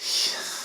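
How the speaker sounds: long exasperated exhale; big sigh. A narrator's reading. Doctor giving up on the humans, big sigh. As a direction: let out a huge acted sigh. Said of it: "gave me the biggest sigh ever".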